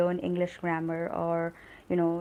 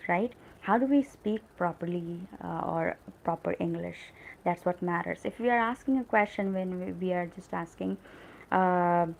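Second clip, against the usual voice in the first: ordinary delivery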